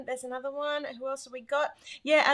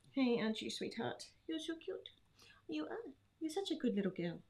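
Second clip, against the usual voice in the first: cooing to a pet; 8 dB quieter than usual. Real, not acted. Host fusses over sweet Elsa, softly cooing. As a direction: murmur sweet nothings to the dog.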